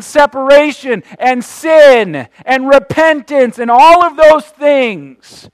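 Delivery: rising, loud preacher's build toward a shout; preaching at full force. Acted. Preacher to congregation, rising full-force preaching.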